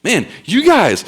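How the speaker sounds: high, exasperated rebuke; hammering them. Retold or imitated. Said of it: "he just hammers him and says".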